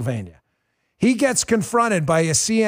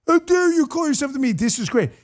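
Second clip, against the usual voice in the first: mock outrage; 7 semitones higher than usual. Mocking the media's outrage.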